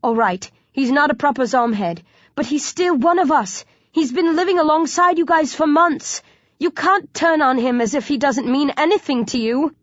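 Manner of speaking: high, insistent appeal; urgent plea. A narrator's reading. Hero defending Mark to the gang, urgently pleading.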